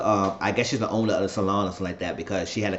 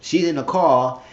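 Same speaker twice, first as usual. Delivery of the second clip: ordinary talk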